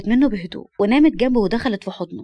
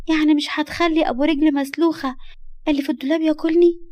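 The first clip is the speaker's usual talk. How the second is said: put-on high, naive child voice; innocent little girl; 7 semitones higher than usual. Little girl afraid of the closet bogeyman, innocent. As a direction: ask with a small child's worry. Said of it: "وقالت ببراءة".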